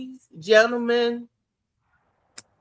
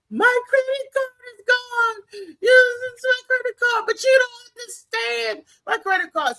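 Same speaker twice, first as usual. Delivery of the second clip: much higher, slow, crying mock scream; screaming meltdown; 13 semitones higher, slower than usual. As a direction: act out her tearful public meltdown. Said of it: "just started screaming".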